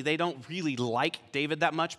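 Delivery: normal talking voice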